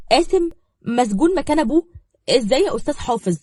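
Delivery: high, loud and urgent; desperate to know what happened; anxious eagerness. A narrator's reading. Zahra begs the lawyer for news, urgently. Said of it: "وقربت منه بلهفة".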